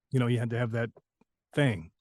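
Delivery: ordinary delivery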